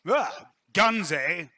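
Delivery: gruff general. Acted. General eyeing a gun enthusiast, gruffly. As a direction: bark it with sudden interest. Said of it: "he looks you up and down and says".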